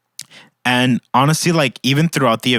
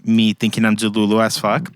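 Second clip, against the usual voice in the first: ordinary delivery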